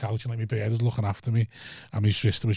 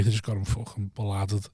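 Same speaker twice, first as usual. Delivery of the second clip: ordinary talk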